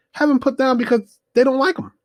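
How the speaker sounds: outraged on the dog's behalf; outraged disbelief. Real, not acted. Telling viewers about the dog, outraged disbelief.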